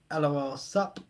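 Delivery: normal talking voice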